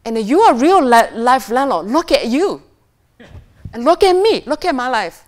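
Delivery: loud, high pointed jabs at the end; daughter's accusation. Retold or imitated. Daughter accusing her landlord mother, loud high jabs. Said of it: "And she says".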